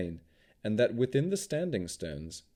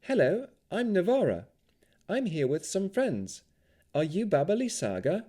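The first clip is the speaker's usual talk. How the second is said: put-on bright, high young woman's voice; a high, friendly young elf; 10 semitones higher than usual. A narrator's reading. Elf greets the hag.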